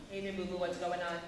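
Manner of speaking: low, level, lifeless delivery; monotone. Shown as a demonstration. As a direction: keep every word on one low note. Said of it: "It's not monotone".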